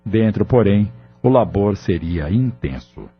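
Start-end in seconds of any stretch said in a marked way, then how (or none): none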